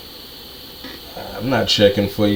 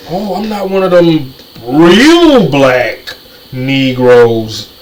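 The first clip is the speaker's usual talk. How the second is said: slow, louder mock of someone distancing himself; mocking impression; 6 dB louder, much slower than usual. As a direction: stretch it out in a sneering mimicry. Mocking imagined speaker.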